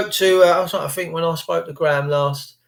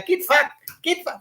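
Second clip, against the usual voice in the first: a much higher, hammed-up Australian accent; mock Aussie accent; 12 semitones higher than usual. Mimicking Aussie slang, in a high mock Aussie accent. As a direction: fling it off like a brash Aussie. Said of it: "That's all they say".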